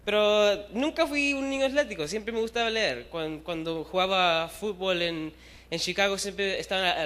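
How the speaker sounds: ordinary talk, nothing marked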